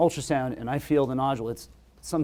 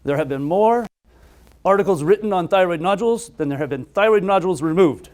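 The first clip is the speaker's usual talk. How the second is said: imitating a thick foreign accent, higher and louder; Ashok Shah's thick accent; 5 semitones higher, 6 dB louder than usual. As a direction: put on a heavy foreign accent and lift the voice. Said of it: "he has this really thick accent".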